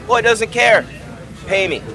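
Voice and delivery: hint of a careless champ shrugging; mock Floyd. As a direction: toss it off like a greedy champ. Mocking Floyd's attitude.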